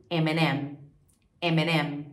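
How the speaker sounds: "M and M" run together as "eminem"; joined up. Shown as a demonstration. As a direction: link "M and M" into one word.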